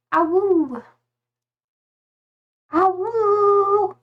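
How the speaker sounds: high playful mock wolf howl; little pup howl, playful. Wolf icon, imitating a howl.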